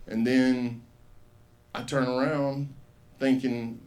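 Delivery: ordinary talk, nothing marked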